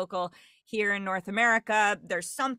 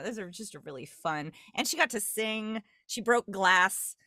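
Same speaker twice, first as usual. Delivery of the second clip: usual speaking voice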